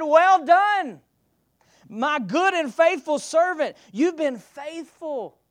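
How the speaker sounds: loud, high proclaiming of well done; God's booming praise. Acted. Preacher voicing God praising the faithful servant, loud and high. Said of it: "He said".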